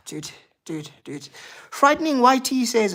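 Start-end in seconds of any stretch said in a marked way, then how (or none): 0.1-1.3 sigh, then low, soft resignation; real deflated sigh of disappointment; dejected. Real, not acted. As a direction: let it out wearily, very quietly. Dejected reply to disappointed viewer.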